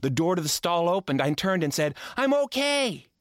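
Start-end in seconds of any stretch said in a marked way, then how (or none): 2.1-3.1 high, hurried I'm okay; high reassurance. Acted. Boy stuck in toilet reassuring friends, high. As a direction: insist you're fine, a bit too high. Caught by teacher.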